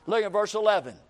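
ordinary talk

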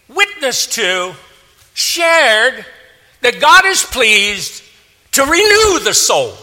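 high, ringing preacher's cry in short bursts; full-force preaching. Real, not acted. Preacher urging believers to share the word, full-force preaching. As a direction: exhort the crowd, driving each key word high.

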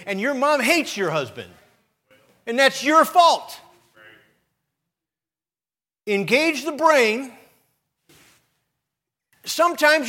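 loud, high, rising sermon shout; preaching at full force. Acted. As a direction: thunder it at the congregation, slowing on the key lines.

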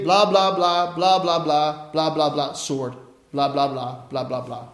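mocking the tuned-out disciples, fading away; dismissive blah blah. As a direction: let it trail off into nothing. Mocking what disciples heard.

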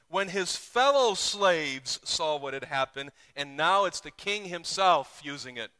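loud preaching, building; full-force preaching. Acted. Pastor pointing the congregation to the verses, loud. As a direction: drive home the repeated phrase.

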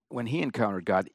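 normal talking voice

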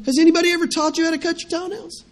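a hint of a teenage daughter asking in disbelief; daughter's voice. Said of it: "She said".

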